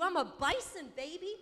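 loud, high self-pumping boast; cocky hype. Acted. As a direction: crow it like a champion. Acted boast of being unstoppable.

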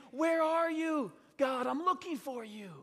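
Mary's urgent call for God; calling out searchingly. Acted. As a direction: cry out for someone you cannot find. Mary calls searching for God.

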